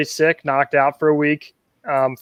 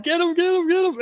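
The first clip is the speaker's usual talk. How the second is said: high, hurried get him; urgent urging; 3 dB quieter than usual. Retold or imitated. Retelling urging partner to grab the fish, excited. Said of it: "I'm like".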